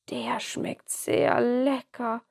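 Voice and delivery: slow, savoring Nikolaus voice; contented old man. Acted. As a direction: say it slowly, enjoying the taste. Nikolaus tastes the cocoa.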